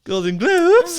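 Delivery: high, loud parody of "Golden Globes"; mocking imitation. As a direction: mimic the botched words again. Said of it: "mocking the accent".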